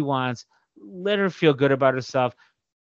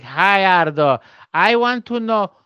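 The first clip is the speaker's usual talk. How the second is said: higher, slow, deliberate mother voice; imitating mom; 6 semitones higher, 5 dB louder, slower than usual. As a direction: mimic mom asking over the phone. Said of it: "And she's like".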